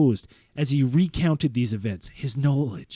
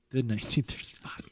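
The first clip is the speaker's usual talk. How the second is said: whispered aside; 9 dB quieter, faster than usual. Real, not acted. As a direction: breathe it under your voice. Aside reacting to own script.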